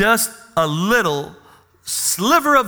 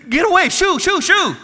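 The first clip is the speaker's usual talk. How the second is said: much higher, mock shooing of the children; a hammed-up, much higher shoo shoo; 11 semitones higher, 4 dB louder than usual. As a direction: brush the kids away like pests. Disciples chasing children away.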